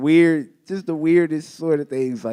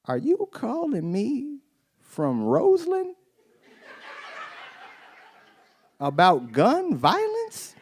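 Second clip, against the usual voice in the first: much higher and slow, disbelieving; incredulous; 11 semitones higher, slower than usual. Retold or imitated. A man asking his Roseland friend a question, high slow disbelief. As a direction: stretch it out in disbelief. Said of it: "I was like".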